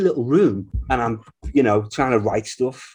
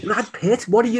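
high, louder incredulous retort; mock disbelief; 12 semitones higher, 4 dB louder than usual. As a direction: act amazed he wants a band. Retold disbelief at Brad Pitt.

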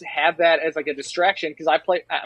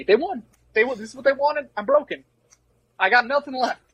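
higher-pitched, defeated whine; mock despair; 7 semitones higher than usual. Recruit telling Dornbush he quit, in mock despair. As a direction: act out giving up, voice lifted. Retold breakdown to a friend.

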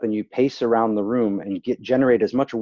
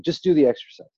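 insistent; 6 semitones higher, 4 dB louder than usual. Retold or imitated. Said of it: "I'm like".

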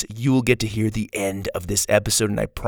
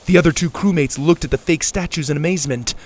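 excited narration; 5 semitones higher, 5 dB louder than usual. A narrator's reading. Action climax narration.

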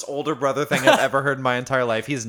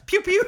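very high little gun noise; mimicked gun pew; 4 dB louder than usual. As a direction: imitate a toy gun, high-pitched. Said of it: "shooting us with a gun".

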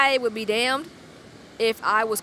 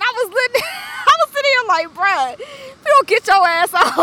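high, loud squeal through real laughter; laughing in disbelief; 12 semitones higher, 7 dB louder than usual. Real, not acted. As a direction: crack up while shouting him away. Cracking up at the man's offer.

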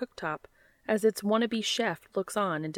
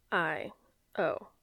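disgruntled; 3 semitones lower, 4 dB quieter than usual. A narrator's reading. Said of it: "comes the disgruntled response".

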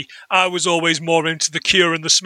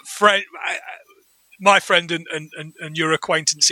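ordinary delivery

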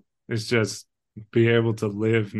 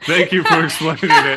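sarcastic through laughter; laughing sarcastic; 6 semitones higher, 8 dB louder than usual. Real, not acted. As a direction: laugh while teasing him.

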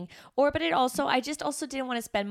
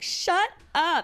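higher, louder embarrassed shut up; dismissive brush-off; 8 semitones higher, 4 dB louder than usual. Retold or imitated. Brushing off her husband's wedding speech, embarrassed snap. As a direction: swat the compliment away.

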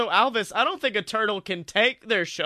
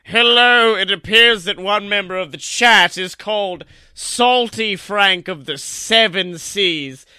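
Momoa Curry proclamation; 4 dB louder than usual. Acted. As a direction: proclaim it like a pompous god of the sea. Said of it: "Momoa has an announcement".

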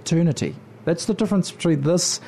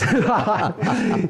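warm laugh; 6 semitones higher than usual. Real, not acted. Laughs among fellow Westies.

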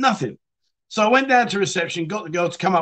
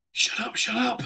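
softer, hurried hushing; urgent hush; 5 semitones higher, 7 dB quieter than usual. Retold or imitated. Hiding him from her boyfriend's call, urgent soft hush. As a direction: hiss him quiet before the phone call.

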